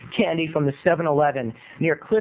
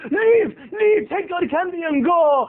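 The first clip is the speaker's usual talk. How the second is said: very high, exasperated mock Indian accent; frantic shop owner, hammy Indian accent; 11 semitones higher, 3 dB louder, slower than usual. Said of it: "giving this guy an Indian accent".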